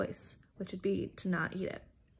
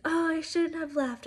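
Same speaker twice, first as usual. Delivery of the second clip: higher-pitched, theatrical wail of regret; hammed-up remorse, pitched up; dramatic Rapunzel; 8 semitones higher than usual. Youth leader acting out Rapunzel's guilt, dramatic mock regret. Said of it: "it's very dramatic".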